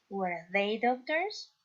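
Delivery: slow, careful English question; teacher modelling a question to the class slowly; slow model reading. Shown as a demonstration. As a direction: spell it out slowly for learners. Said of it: "teacher reads example question".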